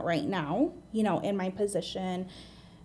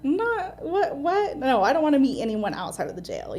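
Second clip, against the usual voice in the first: higher-pitched, incredulous stammer into refusal; retold disbelief; 5 semitones higher, 4 dB louder than usual. Recalling her reaction to meeting outside the jail, incredulous. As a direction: act out being put off by the idea.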